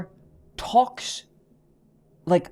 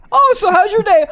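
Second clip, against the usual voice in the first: high, loud mom greeting; hammed-up mom; mock mom voice; 12 semitones higher, 6 dB louder than usual. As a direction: play a chirpy mother asking politely. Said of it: "Introduce her to my mom?".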